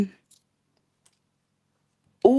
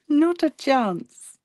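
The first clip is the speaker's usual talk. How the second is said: laughing; 12 semitones higher, much slower than usual. Real, not acted. Emphatic refusal, laughing.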